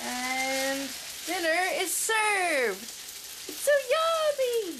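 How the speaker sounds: high, loud, slow sing-song; sing-song announcement. Acted. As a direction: announce it grandly like a waiter. Announcing dinner loudly.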